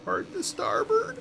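tiny, high, soft squeak of an order; meek voice. Acted. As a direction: give the order timidly, high and slow. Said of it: "In a meek voice".

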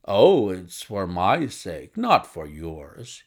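low, slow, loud protestation; emphatic cry. Acted. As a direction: exclaim it bluffly, drawing out the words. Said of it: "he cried".

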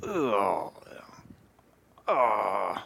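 low, soft disgusted ugh; reluctant groan at God's task; demonstrated cringe groan. Shown as a demonstration. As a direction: groan like you hate the assignment. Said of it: "cringing at the assignment".

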